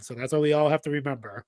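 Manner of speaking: usual speaking voice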